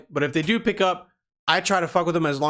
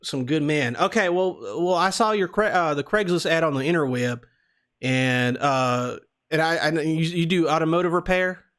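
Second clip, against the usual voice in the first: put-on Southern accent. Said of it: "we're doing the Southern accent".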